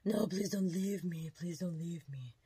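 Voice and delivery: mock begging, drawn out; re-enacted slow, needy plea; imitated pleading. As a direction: act out clinging to someone leaving. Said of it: "then switch over to".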